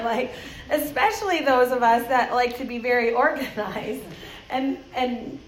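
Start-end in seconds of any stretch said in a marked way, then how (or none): none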